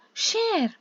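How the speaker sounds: high squeaky Share?; surprised question. Acted. Villagers echo the stranger's advice in high surprised disbelief. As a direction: ask back in disbelief, way up high. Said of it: "asked the people together".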